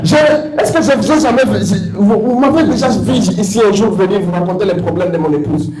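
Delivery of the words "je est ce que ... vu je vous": usual speaking voice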